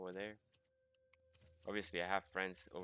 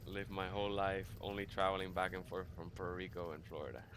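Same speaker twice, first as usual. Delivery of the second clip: ordinary talk, nothing marked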